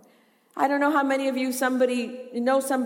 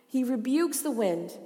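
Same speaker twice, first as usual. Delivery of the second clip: normal talking voice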